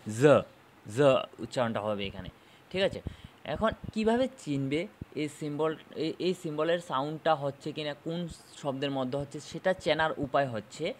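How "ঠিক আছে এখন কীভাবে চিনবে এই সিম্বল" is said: ordinary delivery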